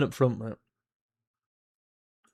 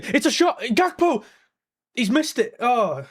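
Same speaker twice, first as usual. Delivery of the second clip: excited shout; 9 semitones higher, slower than usual. Real, not acted. Fan watching Gakpo shoot and miss, excited shout. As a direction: yell it as the ball flies.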